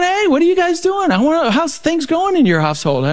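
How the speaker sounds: put-on bright surprised hello to old friends; surprised greeting. Speaker surprised to see Mike and Holly again, high delighted greeting. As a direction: pitch jumps way up on hey.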